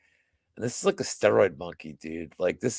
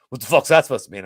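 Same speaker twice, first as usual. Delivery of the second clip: louder, challenging comeback; retold confrontation; 7 dB louder, faster than usual. Angry customer confronting the bar manager, retold challenge. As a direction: throw it back as an angry challenge.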